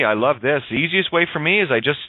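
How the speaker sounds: ordinary talk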